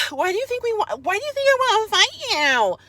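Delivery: mocking high-pitched whine; hammed-up helpless dismay; Alicia. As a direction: play up a distressed, pleading protest far above your own pitch. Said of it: "make fun of Alicia".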